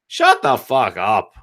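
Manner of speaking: low, slow mock put-down; dismissive retort. Rivals to the company wanting to play catch, low dismissive retort. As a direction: drag it out low to shut down a pest.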